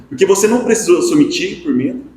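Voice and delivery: ordinary delivery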